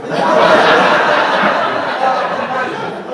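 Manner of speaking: loud airy laugh, rising; real laughter. Real, not acted. Laughs at punchline.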